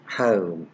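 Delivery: "home" with an ow glide, like "howm"; British-American pronunciation. Shown as a demonstration. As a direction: widen the vowel in "home" into a diphthong. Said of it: "they pronounce the words".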